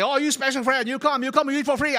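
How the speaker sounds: high, loud eager invitation; welcoming friend. Retold or imitated. Restaurant friend invites him to eat free, high and eager. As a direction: insist warmly and loudly. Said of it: "My friends say".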